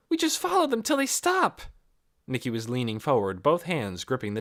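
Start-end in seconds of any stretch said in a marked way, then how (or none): 0.1-1.6 put-on eager girl. Nikki voiced.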